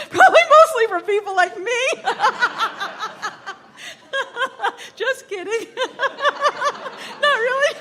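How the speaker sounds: high-pitched, laughing through the words; real laughing fit. Real, not acted. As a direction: crack up at your own joke. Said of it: "be careful about my laugh".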